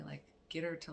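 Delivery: ordinary delivery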